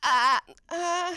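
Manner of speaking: mock memory freeze; blanking on lines. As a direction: draw a blank at an audition. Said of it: "I'd go out and".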